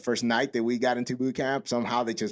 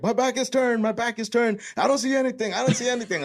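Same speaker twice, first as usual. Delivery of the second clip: loud, very high-pitched mock drill instructor call; 12 semitones higher, 4 dB louder than usual. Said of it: "the drill instructor's just like".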